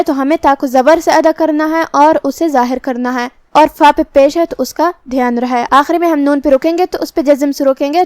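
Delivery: ordinary talk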